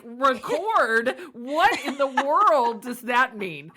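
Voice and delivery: mock bewildered dad; imitating dad. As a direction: play her confused father, slow and baffled. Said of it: "my dad is like".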